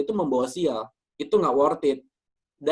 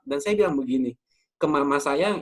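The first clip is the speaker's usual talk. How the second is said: ordinary talk, nothing marked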